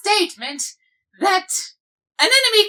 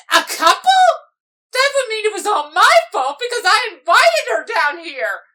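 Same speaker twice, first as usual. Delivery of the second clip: panicked shriek. Acted. Calla Lily realizing the couple is her fault, panicked wail. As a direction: shriek out the horror of it.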